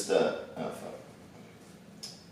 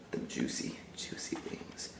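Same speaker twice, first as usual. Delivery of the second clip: whispering; 11 semitones lower, 20 dB quieter than usual. Acted. Whispering about the wings.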